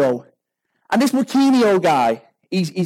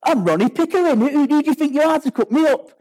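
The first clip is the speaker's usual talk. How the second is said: high-pitched, arrogant indignation; Ronnie Pickering; 8 semitones higher than usual. Acted. Driver to the rider who cut him up, acted arrogance. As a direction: puff yourself up with mock outrage. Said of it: "this kind of arrogance about himself".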